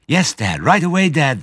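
high, loud and eager; eager cubs. A narrator's reading. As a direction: chirp it and dash off.

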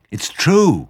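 high, loud eager insist; eager cry. Acted. Small foxes insisting to Badger, high eager. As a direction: convince him, thrilled. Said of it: "cried the small foxes".

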